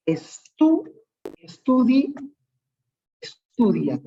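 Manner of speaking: "estu-", "estudi-", then "estudian", pausing between attempts; halting build-up to the full "estudian"; word built gradually. Shown as a demonstration.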